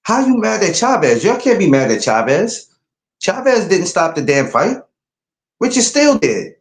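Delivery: high, heated disbelief at angry fans; incredulous retort. Retold or imitated. Retelling what he told angry boxing fans, high incredulous retort. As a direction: demand it in high-pitched disbelief. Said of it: "And I said".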